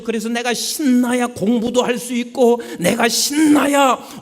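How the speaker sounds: high, loud driving sermon; fervent preaching. Acted. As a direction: preach it hard to the pews. Preaching at full force.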